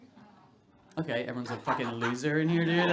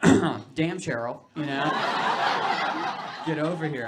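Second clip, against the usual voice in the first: a hammed-up leering call, louder; mock come-on; 3 semitones higher, 7 dB louder, slower than usual. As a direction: leer at her in a joking way. Comic imitates lusty high guy.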